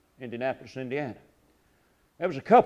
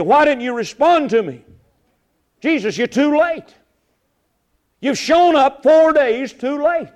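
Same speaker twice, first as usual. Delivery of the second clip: loud, raised cry of complaint to God; anguished plea to God; 8 semitones higher, 6 dB louder than usual. Acted. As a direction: plead with God in pain. Preacher voicing anguished prayer.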